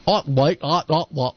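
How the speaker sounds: mock Cockney "Ot", cut short at the end; Cockney dropped T's. Shown as a demonstration. Co-host showing how Cockneys drop their T's, mock Cockney glottal stops. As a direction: say "Ot" with the T swallowed, Cockney-style. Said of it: "don't pronounce the T's".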